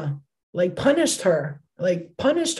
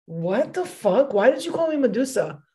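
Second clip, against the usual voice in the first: retold bafflement; 4 semitones higher than usual. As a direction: replay your confused shock at the date. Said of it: "And I was like".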